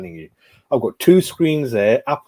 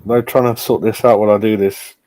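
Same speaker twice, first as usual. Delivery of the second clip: ordinary talk, nothing marked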